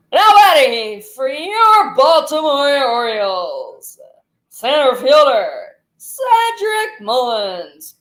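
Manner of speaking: slow, drawn-out ballpark PA announcement; PA announcer voice. Shown as a demonstration. Kid showing off his PA voice, stretched slow. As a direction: boom it out like the stadium PA man. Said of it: "How's my announcer voice?".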